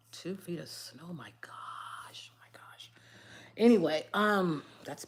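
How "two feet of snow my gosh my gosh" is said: breathy unvoiced whisper, softer. Real, not acted. Reacting to a comment about snow, whispered. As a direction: hush it to yourself in awe. Reading comments aside, hushed.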